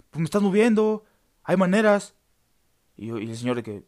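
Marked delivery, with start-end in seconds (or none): 0.1-2.2 louder, higher, indignant telling-off; angry complaint. Retold or imitated. Woken passenger scolding the man who shoved him, angrily. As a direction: scold him for shoving you awake.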